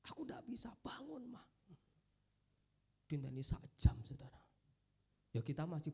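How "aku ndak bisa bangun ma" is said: whispered plea into a mother's ear; imitated pleading whisper. Child whispers to dying mother, pleading whisper. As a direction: breathe the words, begging softly.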